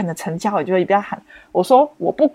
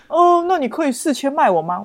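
haggling auntie; 7 semitones higher than usual. Retold or imitated. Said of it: "阿姨还说什么".

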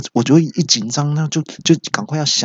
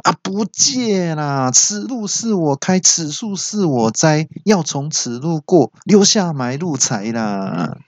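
higher, slow hammed-up highwayman chant; bandit boast; 6 semitones higher, slower than usual. Coworker blocks the aisle, slow hammed-up bandit chant. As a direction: declaim like a bandit at a mountain pass.

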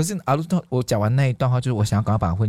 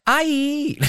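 high, far-carrying call to aunt; bright greeting. Retold or imitated. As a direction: call out to auntie happily. (高声亲热地喊阿姨)

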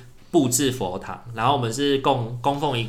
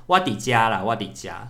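usual speaking voice

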